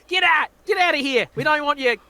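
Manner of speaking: high, loud playful yelling through laughs; mock shouting. Banishing Scottish Christopher Walken from the stream, a laughing mock shout.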